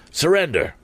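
high, forceful call to surrender; high commanding cry; cried command. A narrator's reading. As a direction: bark it at the men in the boat. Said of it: "he cried".

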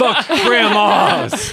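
loud, high, drawn-out cheer through laughter; mock rallying cry. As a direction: shout it slowly, laughing, like a battle cry. Co-host cheers on a complaint.

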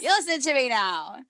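playing the bossy manager for laughs; mock stern boss. Manager mock-scolding her team, hammed-up boss voice. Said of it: "I am the boss".

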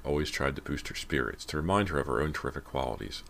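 plain reading voice

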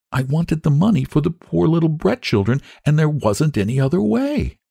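put-on high-pitched, earnest child voice; sweet little girl. A narrator's reading. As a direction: voice a small girl, high and concerned.

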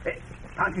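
hushed whisper. Acted. Pancho whispers to Cisco as the bandits ride up. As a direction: whisper so the riders won't hear. Told quiet, bandits coming.

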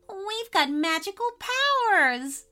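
high, exultant exclamation; laughingly gleeful. A narrator's reading. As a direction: revel in it with acted glee. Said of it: "laughed Chantelle".

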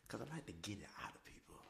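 villain whisper. Retold or imitated.

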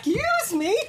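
high, shocked Excuse me; indignant surprise. Retold or imitated. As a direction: act offended and startled. Quoting an indignant reaction.

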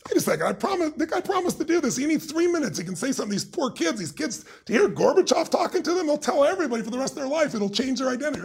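high-pitched indignant rant; pissed-off protest. Retold or imitated. Speaker retells his outrage at the broken interview promise, pissed-off. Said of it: "I'm pissed".

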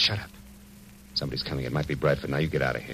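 soft, urgent, hushed warning; detective hushing her as footsteps approach; hushed urgency. Acted. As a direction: keep it down, someone's coming. Hears someone coming, hushes her.

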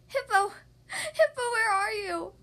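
high, loud worried call. A narrator's reading. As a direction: call out for a lost friend. Duck calls for missing friend.

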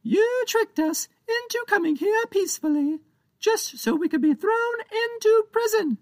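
a pint-sized pirate captain venting his grievance; tiny pirate captain blaming Captain Joe, squeaky and cross; angry tiny pirate. Acted. Captain Big voiced.

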